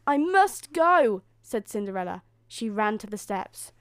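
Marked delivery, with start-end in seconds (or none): 0.1-1.2 high, loud, drawn-out insistence; urgent. A narrator's reading. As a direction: insist over the chiming clock. Cinderella insists on leaving.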